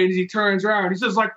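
ordinary talk, nothing marked

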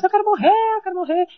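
put-on high, loud childish yell; retold yelling. Child making a scene on the bus, retold yelling. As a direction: cry it out like a kid making a scene. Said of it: "E eu gritava no ônibus".

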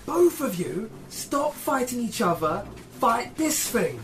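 high, loud commanding call; yelling at both. Acted. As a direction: yell orders to two fighters. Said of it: "I'm gonna yell at both".

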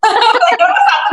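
high-pitched, laughing through the words. Real, not acted. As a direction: let a real laugh spill into the line. Tertawa sebelum menjawab pertanyaan tamu. (sambil tertawa geli)

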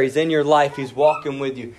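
ordinary talk

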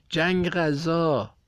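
high, slow, drawn-out battle cry; roaring shout. A narrator's reading. Whole class roaring to start the fight, drawn-out shout. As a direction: bellow it like a war cry. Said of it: "همه نعره زدیم".

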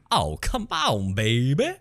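slow, drawn-out bad Southern accent; Keanu Reeves. Podcaster quoting a film line, slow mock Southern drawl. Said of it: "What's that famous line where he does".

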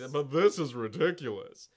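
loud Groucho Marx impression. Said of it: "get Groucho Marx?".

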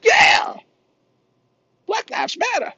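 hammed-up excited ad girl; mocking ad woman. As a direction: gush it like a cheesy ad actress. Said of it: "she's all".

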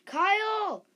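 very high, loud cry of a name; mother screaming for her hurt son; frantic scream. Acted. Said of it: "screamed at the top of her lungs".

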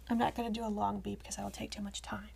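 whispered aside; quick whispered aside. Real, not acted. Aside after bleeping a word.

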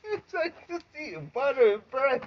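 high, through laughter; laughing. Real, not acted. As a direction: say it while cracking up. Laughing while talking.

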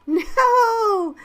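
put-on high, loud, gleeful kid's cry; protesting child. A narrator's reading. Child rejecting teacher's snowman joke, gleeful protest. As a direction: squeal a playful objection.

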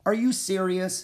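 high-pitched disbelieving question; incredulous. Acted. Jack to Annie about her horse-training plan, incredulous. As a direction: ask it in rising disbelief. Boy doubts friend's plan.

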